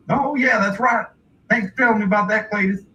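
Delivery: high-voiced dad catching on; hillbilly father. Dad thanks Cletus for the reminder, hillbilly voice. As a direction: take the cue like a rube dad. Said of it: "And then his dad's like".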